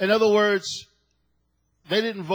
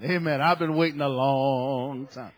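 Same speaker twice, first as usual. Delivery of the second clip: ordinary talk, nothing marked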